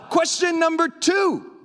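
shouted high and slow from the pulpit; yelling. Real, not acted. Preacher announcing his next point, real shout. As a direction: belt it out to the room. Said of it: "I'm yelling".

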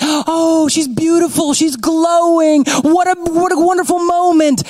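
high, breathless admiration; awed wedding guest. Retold or imitated. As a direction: swoon over the bride coming down the aisle.

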